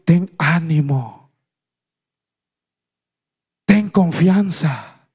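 slow, measured words of reassurance; slow encouragement. Acted. People encourage the blind man Jesus called, slow reassurance. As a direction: draw each word out, unhurried.

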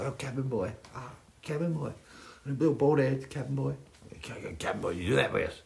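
soft, affectionate mock call of the nickname; teasing Cabin Boy call. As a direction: coo the nickname at him quietly, teasing. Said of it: "He was like".

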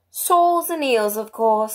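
high, drawn-out opening, testy answer; rather impatient. A narrator's reading. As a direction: answer as if it were obvious. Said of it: "rather impatiently".

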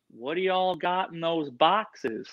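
slow, drawn-out Southern Indiana twang; Southern Indiana accent. Retold or imitated. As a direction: drawl the question like a Southern Indiana local kid. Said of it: "if you don't talk like that".

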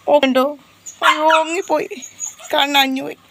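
a squeaky puppy voice; whiny puppy voice. Pretend. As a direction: play a hungry little puppy wailing. Owner voices crying puppy.

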